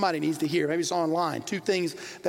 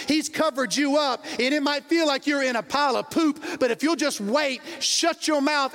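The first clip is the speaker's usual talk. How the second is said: preaching; 6 semitones higher than usual. Acted. Preacher builds to climax.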